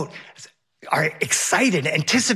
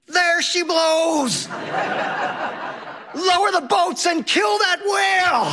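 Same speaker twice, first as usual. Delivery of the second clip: put-on very high-pitched, drawn-out kid scream; hammed-up shriek, words stretched long; Henry screaming; 13 semitones higher than usual. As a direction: cry out like a child playing whaler. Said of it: "And Henry screams".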